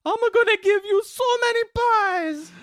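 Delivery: high, loud cartoon Italian waiter. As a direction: belt it like a cartoon Italian chef.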